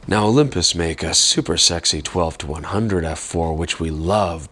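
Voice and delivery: ordinary delivery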